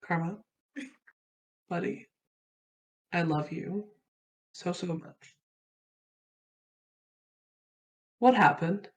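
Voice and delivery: soft, gentle reassurance; tender. Acted. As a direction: coax them softly like a friend. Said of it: "hands on Karma's shoulders".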